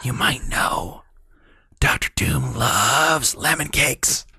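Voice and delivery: hushed conspiratorial whisper. Acted. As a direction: whisper it like a secret. Joking conspiratorial asides.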